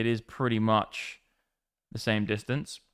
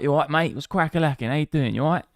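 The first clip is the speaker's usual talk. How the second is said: put-on higher, lively Cockney accent; mock Cockney; 4 semitones higher, faster than usual. Streamer teasing a voice-note sender, mock Cockney accent. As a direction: put on a proper Cockney geezer. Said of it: "You sound like a proper".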